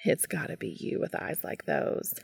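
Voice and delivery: soft, low-key, mildly amused; hushed, fond amusement; chuckled. A narrator's reading. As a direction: say it softly with a touch of amusement. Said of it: "Cass chuckled".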